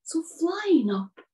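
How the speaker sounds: ordinary delivery, nothing marked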